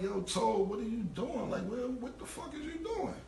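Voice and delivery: rising, incredulous what are you doing; shocked outburst. Retold or imitated. To his driver, finding a kid in the car, rising disbelief. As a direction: woken up and baffled, pitch climbing high.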